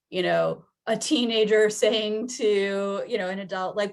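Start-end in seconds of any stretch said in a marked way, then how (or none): none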